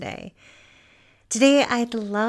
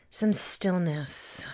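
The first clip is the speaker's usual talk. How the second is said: guided meditation. Acted. Teacher guiding listeners to feel supported and present, calm meditation voice. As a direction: settle them gently into stillness, phrase by phrase.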